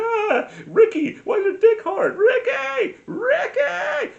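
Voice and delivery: shrill falsetto mom screeching Ricky's name, very high and loud; mom shrieking. Retold or imitated. Son imitating his mom catching him, shrieking falsetto. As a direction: shriek it like a scandalised mother, way up high. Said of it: "She'd be like".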